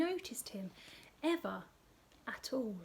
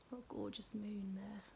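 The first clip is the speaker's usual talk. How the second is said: whisper; 3 semitones lower, 11 dB quieter than usual. Acted. Hushed aside.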